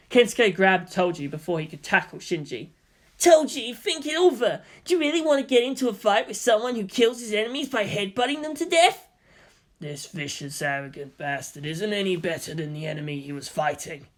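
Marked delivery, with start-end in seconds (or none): 3.2-9.1 excited friend begging Toji to stop; urgent plea. A narrator's reading. Kensuke pleading with Toji. 9.8-14.1 low sneer. A narrator's reading. Toji sneering at Shinji.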